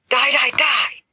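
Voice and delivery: high, loud hammed-up war cry; playfully savage chant of die; mock battle cry. James pretending to slay evil pumpkins, hammed-up war cry.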